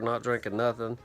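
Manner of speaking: ordinary talk, nothing marked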